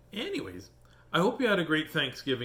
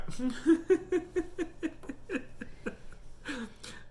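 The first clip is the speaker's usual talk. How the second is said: breathless giggle. Real, not acted. Laughs at hiccups returning.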